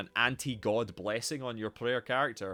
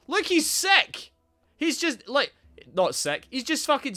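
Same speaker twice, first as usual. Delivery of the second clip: excited outburst; 11 semitones higher, 5 dB louder than usual. Real, not acted. Excited outburst about the game.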